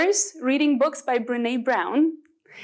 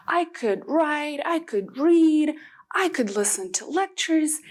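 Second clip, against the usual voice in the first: ordinary talk, nothing marked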